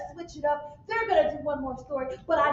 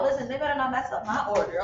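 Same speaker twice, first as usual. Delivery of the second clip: usual speaking voice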